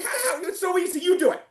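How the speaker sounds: high, incredulous mock retort to doubters; mock exasperation. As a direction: toss it back in a high, disbelieving voice. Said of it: "I'm like".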